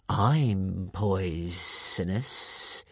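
very slow, drawn-out sibilant menace; hissing creature. A narrator's reading. Monster boasting to its prey Hiccup, slow menacing hiss. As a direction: stretch each word into a hiss. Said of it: "hissed the creature".

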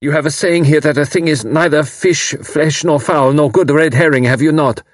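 put-on young German man at breaking point; agitated German refugee. A narrator's reading. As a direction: pour it out in rising despair. Karl in despair to Tuppence.

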